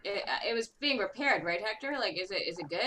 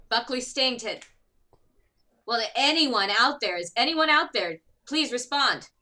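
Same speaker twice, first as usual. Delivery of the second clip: loud, raised distress-call voice; projected, higher-pitched radio hail; general all call; 4 semitones higher, 8 dB louder than usual. Acted. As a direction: call out to anyone listening. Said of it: "give out, like, a, just a general all call".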